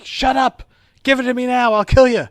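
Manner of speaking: high, loud hammed-up screaming threat; mock furious yell. Host imitating Mirror Spock threatening a crewman, mock furious yell. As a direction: yell it like a cartoon tyrant losing it. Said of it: "And he's like".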